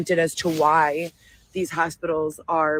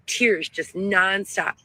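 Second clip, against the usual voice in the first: ordinary talk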